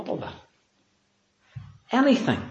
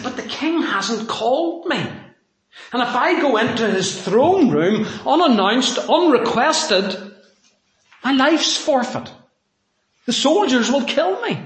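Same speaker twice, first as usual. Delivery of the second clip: higher, louder, urgent plea as queen Esther; Esther pleading; 9 semitones higher, 4 dB louder than usual. Acted. Queen Esther to Mordecai about the king, higher frightened plea. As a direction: voice a frightened queen begging her uncle to understand. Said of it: "And she said".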